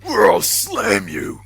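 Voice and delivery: loud, high wrestler growl; Hulk voice. Retold or imitated. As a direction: bellow it like a pumped-up wrestler. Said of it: "We good, Hulk?".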